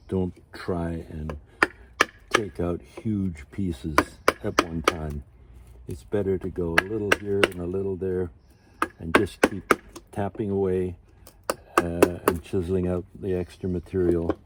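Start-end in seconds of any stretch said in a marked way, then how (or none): none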